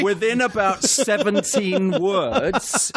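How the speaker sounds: high, drawn-out mock Indian accent; botched Welsh accent sliding into Indian, laughing; slow, comically wrong Indian-sounding accent; Welsh accent turning Indian. Shown as a demonstration. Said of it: "it is Gandhi".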